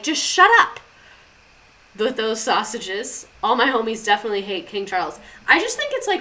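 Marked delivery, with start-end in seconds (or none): 0.0-3.3 exasperated outburst. Real, not acted.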